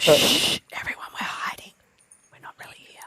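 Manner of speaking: whispering. Pretend. Hiding whisper game.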